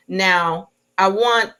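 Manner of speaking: usual speaking voice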